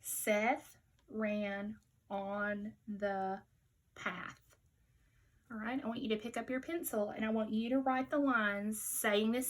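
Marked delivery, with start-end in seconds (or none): 0.0-4.4 stretched slow words together; slow choral reading. Shown as a demonstration. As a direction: say it slowly with the class. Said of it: "Say it with me again".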